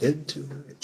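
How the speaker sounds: hushed, quizzical mimicry; teasing echo. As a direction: quietly toy with the borrowed phrase. Mock-echoes the odd phrase again.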